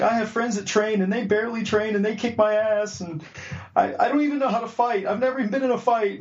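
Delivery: high-pitched mock tearful whining; near-tears confession. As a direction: imitate a guy almost crying as he admits defeat. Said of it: "almost started crying".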